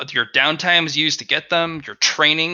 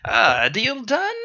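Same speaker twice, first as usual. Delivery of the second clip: slow, higher sly merchant; 8 semitones higher, much slower than usual. Acted. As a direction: seal the deal with relish. Merchant closes deal.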